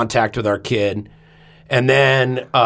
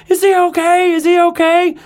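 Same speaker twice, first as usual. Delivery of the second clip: anxious mother; 4 dB louder than usual. Retold or imitated. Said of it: "Sarah's like".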